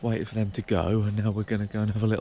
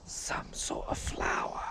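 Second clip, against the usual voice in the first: slow unvoiced whisper; 9 dB quieter, much slower than usual. Real, not acted. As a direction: breathe it out slowly. Hushed observation in woods.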